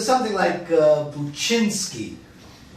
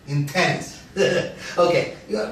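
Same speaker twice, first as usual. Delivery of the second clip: ordinary talk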